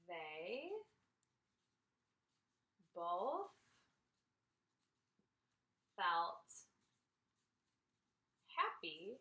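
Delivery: slow, word-by-word dictation; spaced out word by word as she writes; dictating. Shown as a demonstration. Teacher writes the same feeling on the diagram, slow dictation. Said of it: "dictating while writing".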